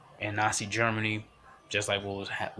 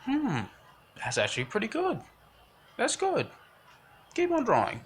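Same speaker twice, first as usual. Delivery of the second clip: much higher, falsetto-like mock praise; approving art teacher; 12 semitones higher than usual. Guy praising Kyle's bear drawing, high approving voice. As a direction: act out an approving teacher, pitched way up. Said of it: "Instead of just saying".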